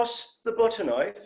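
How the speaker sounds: lightly put-on, prim phrasebook politeness; stilted textbook Standard. Alien keeps performing his Standard despite the doctor's pleading, prim and formal. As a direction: ask it like a line from a language tape. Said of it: "please don't speak standard".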